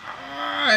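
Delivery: high suspended "ah", held in the air; a drawn-out high cry of being left dangling; unresolved high hum of suspense; left-hanging "ah". Acted. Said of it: "Doesn't that leave you like".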